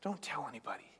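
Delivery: hushed secret instruction; whispered warning. Retold or imitated. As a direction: lean in and whisper it. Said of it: "but then Jesus is like".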